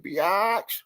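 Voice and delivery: high, drawn-out, rising "biatch"; playful catchphrase yelp. Pretend. Radio host capping "tell a friend" sign-off, playful high yelp. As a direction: stretch it out and send the pitch soaring.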